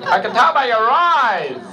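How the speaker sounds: put-on high, accented, chirpy bandleader voice. Bandleader interviews a hip trumpet player, high and chirpy. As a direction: play the square TV bandleader hiring a jazzman.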